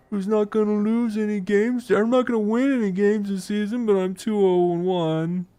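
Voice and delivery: high, nasal whine; whining voice. Pretend. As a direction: whine it like a sore loser. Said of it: "that's my soul whining voice".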